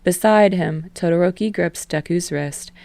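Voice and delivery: plain reading voice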